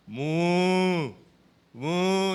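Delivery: mock mooing like a cow. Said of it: "sumunod naman yung baka".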